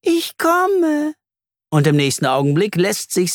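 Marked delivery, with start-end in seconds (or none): 0.0-1.1 very high, thin spider voice; high-pitched little creature call; high whispery voice. A narrator's reading. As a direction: answer in a high, wispy sing-out. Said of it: "Eine helle Stimme wispert".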